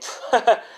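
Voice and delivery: short high-pitched scornful laugh; mocking laugh. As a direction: laugh at the boy, acted. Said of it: "As they laugh".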